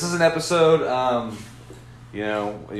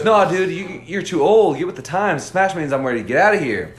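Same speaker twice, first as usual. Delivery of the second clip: mock know-it-all; 7 semitones higher, 6 dB louder than usual. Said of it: "And he goes".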